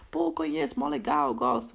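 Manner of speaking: imitating the date. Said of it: "Aí eu falei".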